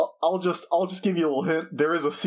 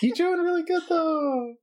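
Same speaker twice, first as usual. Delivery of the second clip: high, encouraging ghost; high-pitched ghost, half laughing; ghostly voice; 14 semitones higher than usual. Acted. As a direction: reassure them in a high spook voice. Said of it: "you hear a ghostly voice".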